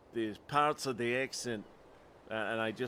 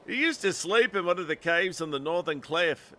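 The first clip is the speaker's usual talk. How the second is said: put-on higher, more emphatic Scottish accent; renewed Scottish accent; 4 semitones higher than usual. Lookout describing stranger's cave, firm Scottish accent. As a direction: restart with a stronger put-on Scottish voice. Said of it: "wow, I lost it".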